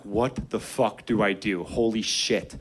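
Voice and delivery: slow, low stunned panic; panicked kid. Retold or imitated. Kid facing a block, low slow dread. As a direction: drag it out in dread. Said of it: "And I'm like".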